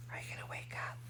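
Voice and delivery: hushed whisper so as not to wake him. Real, not acted. Host to sleepy puppet Chester at bedtime, whispering.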